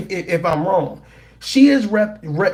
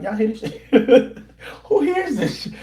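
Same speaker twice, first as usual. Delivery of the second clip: laughing quote; 4 semitones higher than usual. Retold or imitated. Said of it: "the guy on the microphone was like".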